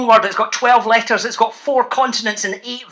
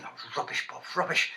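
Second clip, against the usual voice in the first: unvoiced mock whisper of the kids; 6 semitones lower, 14 dB quieter than usual. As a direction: breathe it as a teasing aside. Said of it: "You're in saying".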